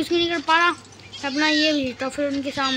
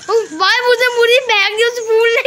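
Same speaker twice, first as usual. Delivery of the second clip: higher and louder, protesting; indignant outcry; 5 semitones higher, 4 dB louder than usual. Real, not acted. Gamer outraged the flower threw him, loud outcry. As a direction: complain loudly at the game. Flower throws his bird away.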